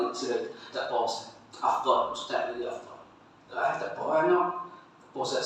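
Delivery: very soft, half-voiced murmur; low, barely voiced polite refusal; hushed reluctant reply. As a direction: decline under your breath, deferring to the ustaz. Acts out relatives declining to lead prayer.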